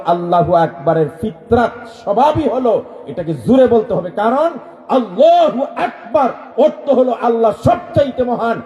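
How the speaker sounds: ordinary talk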